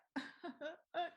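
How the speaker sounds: high pretend sobs; mock blubbering; imitated crying. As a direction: mimic a husband bursting into tears.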